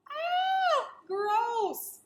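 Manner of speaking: high squeal then a disgusted cry; squeal, then spit out the disgust; mock disgust shriek. Teacher hamming up disgust at the bats for the first grade. Teacher reacts to blood-sucking.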